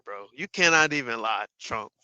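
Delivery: ordinary delivery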